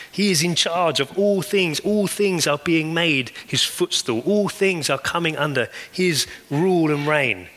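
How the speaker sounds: rising sermon climax over music; preaching at full force. Real, not acted. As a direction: proclaim his kingship over all things.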